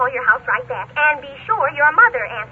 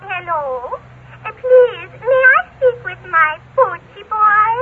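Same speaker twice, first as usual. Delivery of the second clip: higher, pleading put-on Spanish accent; Spanish accent, eager and pleading; 6 semitones higher, 3 dB louder than usual. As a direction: coax in a fake Spanish lady's voice. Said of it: "speaks with a Spanish accent".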